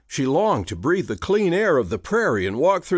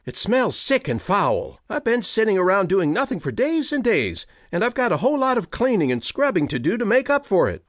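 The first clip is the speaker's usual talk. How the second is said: fast exasperated rant; 8 semitones higher than usual. Acted. Hannah's rising rant.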